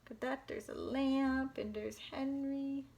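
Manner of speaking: ordinary delivery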